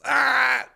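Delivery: sudden high-pitched yelp; high, loud shriek. Pretend. Sudden mock cry amid reading.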